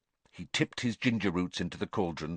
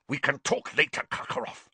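higher, then the name hissed out unvoiced; spat; 5 semitones higher than usual. A narrator's reading. Snape dismissing Karkaroff, spitting the name. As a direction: spit the name out in contempt. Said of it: "spat Snape".

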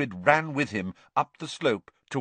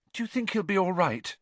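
much higher, worried question; nervous Hermione; 9 semitones higher than usual. A narrator's reading. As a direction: ask nervously, pitched up. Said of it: "said Hermione nervously".

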